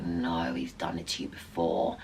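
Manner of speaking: very low, soft voice; grim knowing aside. Retold or imitated. Doorwoman reacting to the betrayed wife, low grim aside. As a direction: drop right down, quietly certain.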